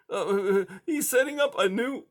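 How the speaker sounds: high, hesitant scared mouse; timid high little voice; frightened mouse. A narrator's reading. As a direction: stammer it out in fear.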